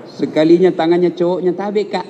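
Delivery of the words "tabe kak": sugary mock-polite girl saying "Tabe, Kak"; a teasing imitation of an overly polite daughter; mock sweet daughter. Mocking polite daughter to boyfriend. (meniru anak perempuan yang sok sopan)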